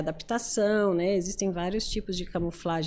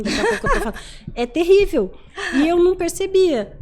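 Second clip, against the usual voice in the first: laughing; 9 semitones higher, 7 dB louder than usual. Real, not acted. Laughing at her own habit.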